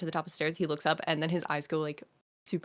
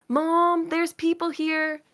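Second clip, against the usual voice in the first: high, loud call to mom across the hall; child calling out loud and high; kid yelling for mom; 12 semitones higher, 8 dB louder, much slower than usual. Acted. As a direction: shout for your mother from the doorway. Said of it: "And he's yelling".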